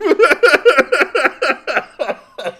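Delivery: high, loud burst of laughter; real loud laughter; long laugh. Real, not acted. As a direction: let the laugh roll out. Laughing at own quote.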